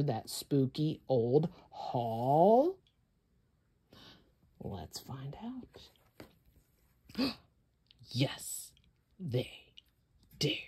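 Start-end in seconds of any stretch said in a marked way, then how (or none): 4.6-10.6 a soft conspiratorial hush; hushed whisper. A narrator's reading. Storyteller whispering before the reveal. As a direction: whisper it secretly.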